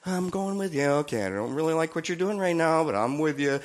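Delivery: gently resigned, still loyal; Holy Spirit voice. Retold or imitated. Holy Spirit speaking softly to a wayward believer. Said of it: "This is the Holy Spirit".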